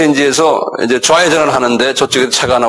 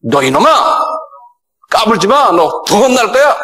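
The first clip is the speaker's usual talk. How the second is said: scolding that climbs higher with each line; stern rebuke; 6 semitones higher than usual. Retold or imitated. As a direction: tell the devil off, rising in pitch. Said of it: "rebuke 한다 그래요".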